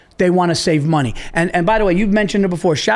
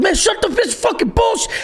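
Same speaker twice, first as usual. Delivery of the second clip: very high-pitched mock shouting; imitated furious heckler; 14 semitones higher than usual. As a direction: imitate an enraged audience member yelling. Angry heckler in retold story.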